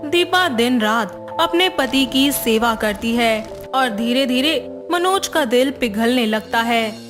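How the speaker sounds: plain reading voice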